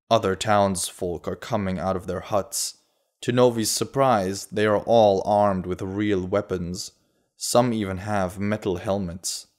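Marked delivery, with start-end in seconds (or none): none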